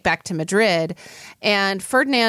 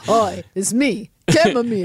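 chamomile voice. Acted. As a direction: drag it out in a funny voice. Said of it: "is that the voice?".

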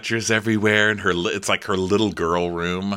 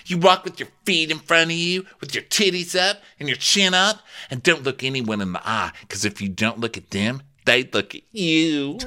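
mock ditzy girl voice; 7 semitones higher than usual. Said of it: "And she goes".

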